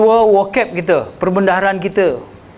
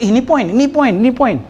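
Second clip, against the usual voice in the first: lightly mocking, a bit higher, repeating the word poin; imitating someone; 6 semitones higher than usual. Teacher imitates someone who calls everything a point, lightly mocking. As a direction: mimic a fussy speaker insisting on each poin. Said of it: "Bila orang kata".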